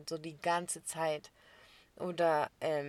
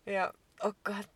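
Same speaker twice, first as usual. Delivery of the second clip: ordinary delivery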